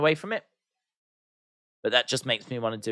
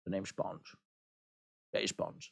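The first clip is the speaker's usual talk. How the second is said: put-on soft, low Sean Connery accent with a slushy s; a quiet Sean Connery Bond line; 7 semitones lower, 16 dB quieter than usual. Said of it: "it just automatically goes Sean Connery".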